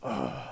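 put-on low grudging groan of a teenage son; reluctant groan. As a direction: groan like a kid sent to do chores. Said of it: "he'll go".

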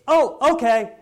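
mock cheerful acceptance, high and loud; Habakkuk. Prophet supposedly accepting God's verdict, in mock high-pitched agreement. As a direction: pipe up in hammed-up agreement. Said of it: "And Habakkuk goes".

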